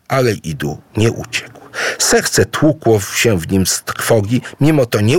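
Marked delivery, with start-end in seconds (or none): none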